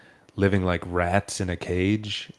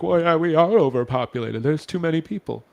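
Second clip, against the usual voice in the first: higher, whiny mock voice of a pushover; mocking a cuck; 8 semitones higher than usual. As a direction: sneer at him by sounding weak. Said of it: "enough of a cuck to be like".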